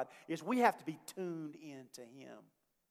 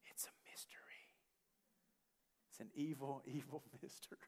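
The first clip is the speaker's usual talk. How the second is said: whisper; 12 dB quieter, faster than usual. Real, not acted. Aside joke about buffet hunger.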